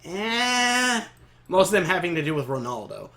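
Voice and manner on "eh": high, drawn-out noncommittal noise; unimpressed. Shown as a demonstration. Fan on the few episodes they dislike, unimpressed. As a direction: draw out a lukewarm sound. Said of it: "I'm just like".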